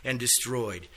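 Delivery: loud, ringing scripture reading. Acted. Teacher reads Isaiah aloud to the class, loud proclaiming. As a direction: proclaim the verse loudly to the class.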